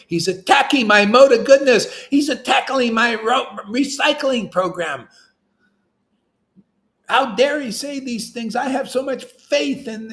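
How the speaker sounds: high, whiny mock complaint; hammed-up indignant devotee voice, pitched high; mocking offended devotee. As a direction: send up a huffy devotee defending his path.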